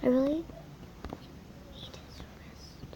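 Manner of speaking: soft whispered aside; whispering. Real, not acted. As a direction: breathe it under your breath. Whispered aside while trading.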